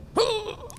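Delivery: sharp high gasp, hammed up; over-the-top gasp for a one-line role; mock dramatic gasp. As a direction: gasp like a shocked extra in a film. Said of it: "one line where we go".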